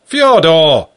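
loud call with falling end; calling again. Acted. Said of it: "I repeated".

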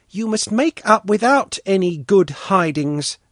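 high-voiced, earnest child; earnest little girl. A narrator's reading. Svetlana telling the boys to make up, earnest and high. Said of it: "Svetlana said earnestly".

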